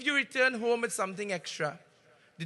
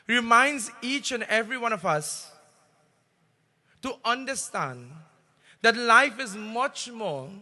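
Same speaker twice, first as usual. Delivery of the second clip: a temple preacher rousing his devotees; fervent sermon; 3 dB louder than usual. Acted. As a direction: preach it out, swelling loud on the key words.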